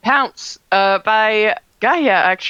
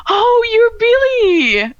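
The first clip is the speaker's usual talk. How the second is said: delighted recognition; 12 semitones higher, 4 dB louder, much slower than usual. Acted. Gaia recognizes Billy, delighted.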